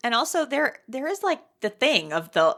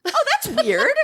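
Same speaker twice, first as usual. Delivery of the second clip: high, laughing, too-casual shrug; mock casual; slower than usual. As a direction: toss it off like it's nothing. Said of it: "they're both just like".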